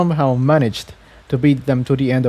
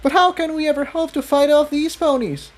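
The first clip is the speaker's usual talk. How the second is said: high, anxious filly voice; a frightened question shouted mid-chase; young pegasus worrying aloud to Jigsaw; worried shout. A narrator's reading. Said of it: "Tiptoe shouted back".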